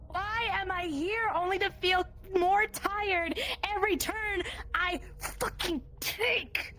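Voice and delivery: usual speaking voice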